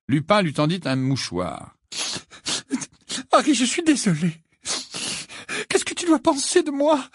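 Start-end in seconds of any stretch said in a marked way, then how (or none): 3.3-7.1 high, tearful, trembling apology; trembling stammer. A narrator's reading. Missus Wesley apologises to Harry, trembling voice. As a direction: apologise through sobs, voice trembling. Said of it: "bredouilla-t-elle d'une voix tremblante".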